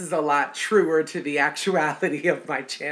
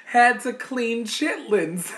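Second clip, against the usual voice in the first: slow and higher, amused; laughing; 7 semitones higher, 3 dB louder, slower than usual. Real, not acted. Admitting to viewers she cleaned chitlins, laughing. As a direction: drag it out, fighting the giggles.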